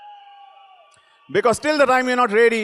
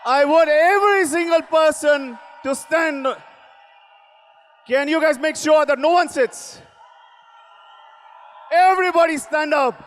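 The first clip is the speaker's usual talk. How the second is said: rousing crowd call, pitched up; booming motivational speech; 5 semitones higher than usual. Real, not acted. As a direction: get them on their feet.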